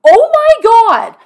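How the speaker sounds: high, loud, slow Oh my God; drawn-out shocked outcry. Retold or imitated. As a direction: gasp it out, aghast at the news. Hears brother-in-law is protesting.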